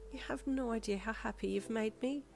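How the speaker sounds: high, lightly bubbly delight. A narrator's reading. Woman thanking friend for a gift, mildly bubbly. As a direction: say it with understated, lifted happiness. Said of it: "she said in a bubbly voice".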